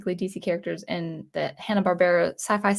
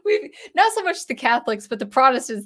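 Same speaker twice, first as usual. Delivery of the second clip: normal talking voice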